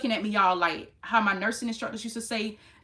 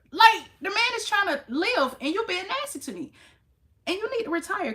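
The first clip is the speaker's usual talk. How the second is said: indignant rant; 11 semitones higher, 4 dB louder than usual. Real, not acted. Venting to viewers about the rude clerk, indignant and raised. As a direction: let the outrage climb as you vent.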